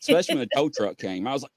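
ordinary delivery

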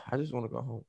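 soft, fast tired sigh of a line; weary sigh. Retold or imitated. Fed up friend wanting to leave, weary sigh. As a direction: breathe it out, worn down.

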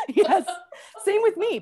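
high, loud, through real laughter; laughing agreement. Real, not acted. Co-host agreeing it's a hard pass, laughing loudly. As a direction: agree while cracking up, voice shooting up.

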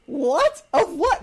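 mock disbelief. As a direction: react stunned and baffled. Retells disbelief at friend.

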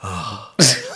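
long defeated sigh; resigned sigh. Retold or imitated. Giving in to God with a resigned sigh. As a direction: sigh like a scolded kid. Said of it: "a sigh of".

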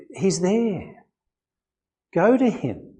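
normal talking voice

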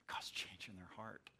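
a breathy whispered aside, hushed and intimate. Real, not acted. Preacher whispers God's work to the congregation, hushed. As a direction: lean in and whisper it like a secret of grace.

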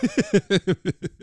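loud, slow disbelief; exasperated. Retold or imitated. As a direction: drag it out, fed up. Exasperated retold quote, loud slow.

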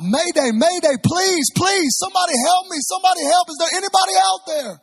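very high, loud, desperate distress call; shouting out. Retold or imitated. Nervous passenger grabs the radio as plane descends, shouting out. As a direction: scream for rescue, acting it in full earnest. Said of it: "starts shouting out".

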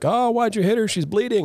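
high-pitched acted indignation; mock upset protest. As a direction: protest like a distressed boyfriend, hammed-up. Acting out Liam's confrontation.

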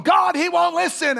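high, loud frustrated cry to God; high exasperated plea; exasperated complaint. Retold or imitated. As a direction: throw it up to heaven in exasperation. Preacher acting his own complaint.

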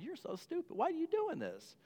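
high, soft mock scold. People looking down on a bad decision, high mock scolding.

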